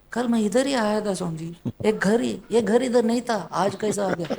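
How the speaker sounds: high-pitched, hurried protest; high mock-puzzled devotee voice; mimicking a baffled devotee. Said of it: "I am mimicking him".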